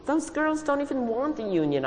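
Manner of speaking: plain reading voice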